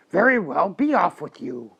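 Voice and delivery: loud, gruff troll dismissal; roaring troll. A narrator's reading. Troll lets the second goat go, loud and gruff. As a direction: send them off with a troll's roar. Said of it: "roared the troll".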